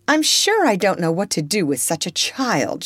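plain reading voice

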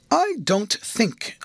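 "I don't think" slowly and neatly emphasised; nicely measured stress on "don't think". A narrator's reading. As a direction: start high on "I", then place "don't think" with care. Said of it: "with a very nice and neat emphasis on those two words".

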